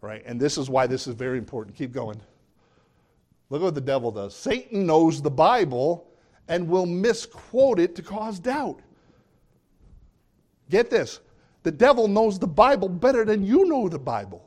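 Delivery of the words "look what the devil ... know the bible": rising, louder preaching; preaching at full force. Acted. Pastor preaching louder to his flock. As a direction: preach it up to the congregation.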